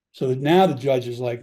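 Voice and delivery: usual speaking voice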